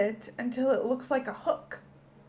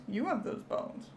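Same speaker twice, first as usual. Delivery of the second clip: hushed; 7 dB quieter than usual. Acted. Marvelling at an X-ray.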